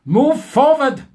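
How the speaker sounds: mock German professor. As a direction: ham up a self-important German scientist. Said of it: "of German extraction".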